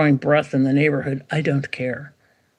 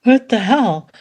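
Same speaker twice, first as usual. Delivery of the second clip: mock bafflement, pitched up; baffled buyer; 6 semitones higher than usual. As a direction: sound thrown by an odd question. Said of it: "They're like".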